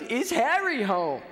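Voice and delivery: high, loud, slow Boston landlord; Boston accent. Retold or imitated. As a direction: repeat it insistently in mock Boston accent. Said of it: "this very strong Bostonian accent".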